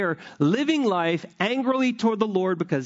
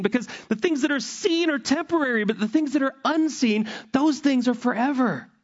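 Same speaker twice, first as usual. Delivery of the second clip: higher, ringing preacher's cry; preaching at full force, much higher; impassioned preaching; 8 semitones higher than usual. Real, not acted. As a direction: lift the voice and plead from the pulpit.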